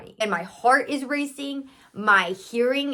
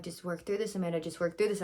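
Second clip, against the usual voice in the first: hushed self-talk; 4 semitones lower, 11 dB quieter, faster than usual. Retold or imitated. Self-talk while feeling dizzy.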